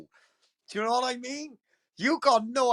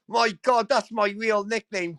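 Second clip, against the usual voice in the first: usual speaking voice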